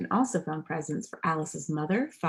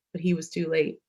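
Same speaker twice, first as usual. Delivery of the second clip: ordinary delivery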